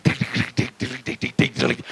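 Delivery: rapid voiceless tongue-tip ticking; imitated ticking. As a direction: tick away like a percussion noise. Mimics the fire act noises.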